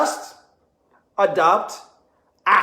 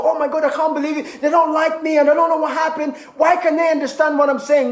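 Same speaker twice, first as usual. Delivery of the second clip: higher, flustered, mock distress; mock emotional wail; 6 semitones higher, faster than usual. Speaker mocks the flustered communicator, high and panicky. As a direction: ham up being tangled up and upset. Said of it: "get all tangled up and emotional and go".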